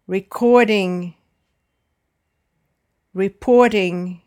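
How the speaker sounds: re-COR-ding, re-POR-ting, middle syllable punched; second-syllable stress. Shown as a demonstration. Teacher models "recording", "reporting" for repeating, stressed on the second syllable. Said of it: "except computing, recording, and reporting".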